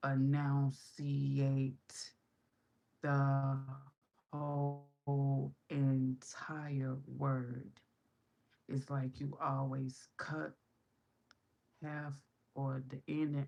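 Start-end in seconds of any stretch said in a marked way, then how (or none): 0.1-7.7 very slow, deliberate, each word drawn out; slow, emphatic over-enunciation; over-enunciated. Shown as a demonstration. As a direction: stretch and fully sound out every word. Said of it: "you don't wanna pronunciate, enunciate".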